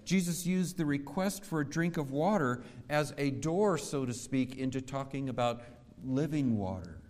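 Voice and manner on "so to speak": normal talking voice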